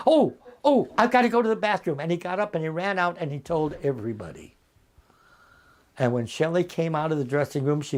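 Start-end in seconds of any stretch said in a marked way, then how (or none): 0.1-2.0 high, hurried mock excuse; feigned urgency. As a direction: blurt an excuse and flee. Acts out his excuse to escape rehearsal.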